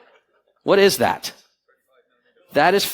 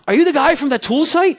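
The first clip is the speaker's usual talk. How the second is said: higher, louder, eager question; lightly voiced keen staffer; eager hotel man; 11 semitones higher, 3 dB louder than usual. Head of rooms finds him at lunch, eager. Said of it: "He's like".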